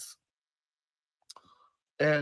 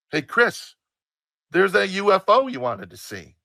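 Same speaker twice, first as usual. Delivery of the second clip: put-on higher, louder mother calling to her kid; anne seslenişi; 8 semitones higher, 8 dB louder than usual. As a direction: call out brightly to get the kid's attention. Mother calls out to son.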